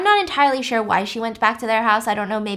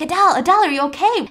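higher, louder frantic calling; mock panic, raised and urgent; panicked calling; 6 semitones higher, 5 dB louder, faster than usual. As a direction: call out in a panic through the window. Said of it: "freaking out and banging on the window".

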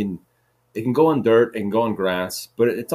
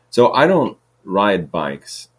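louder slow restart; 8 dB louder, much slower than usual. Shown as a demonstration. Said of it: "repeat of line 48".

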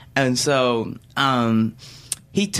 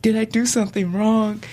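put-on small worried kid, pitched up; higher-pitched schoolkid fearing trouble; young childish voice; 6 semitones higher than usual. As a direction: imitate a child timidly asking. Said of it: "In my young, childish voice".

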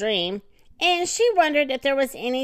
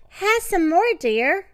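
sweet offering voice; 6 semitones higher than usual. Acted. Wife offers toast in sleep.

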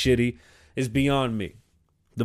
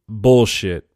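scornful call-out; 5 semitones lower, 6 dB louder than usual. Real, not acted. Calling out the officer's excuse.

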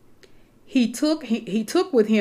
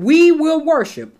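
we punched louder and higher; stress on we; 7 semitones higher, 6 dB louder than usual. Shown as a demonstration. Preacher drives home to the saints that it was we. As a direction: hit the word we hard. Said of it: "notice that Abraham said "we".

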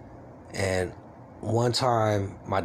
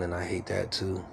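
normal talking voice

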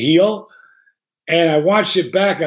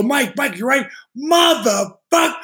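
much higher, worried calling out, slowing on the curse; alarmed shouting; 7 semitones higher than usual. Retold or imitated. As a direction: shout in panic at a friend on the line. Alarmed reply on phone.

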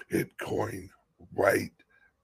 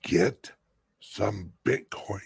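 ordinary talk

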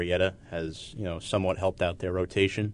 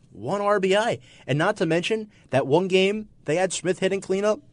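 ranting impression; 10 semitones higher than usual. Continuing the Francesa rant.

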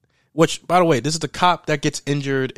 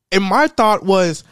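ordinary talk, nothing marked

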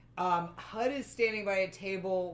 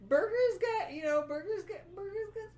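flustered squeal; 14 semitones higher than usual. Real, not acted. Flustered over attractive character.

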